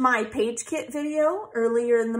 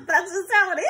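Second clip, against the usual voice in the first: laughing; 8 semitones higher, 4 dB louder than usual. Real, not acted. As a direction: laugh through the admission. Laughing at own joke.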